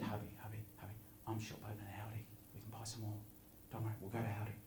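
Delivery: whispering wife. Acted. Wife whispering to husband to keep bidding, soft whisper. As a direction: lean in and whisper to hubby. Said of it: "They're whispering over here".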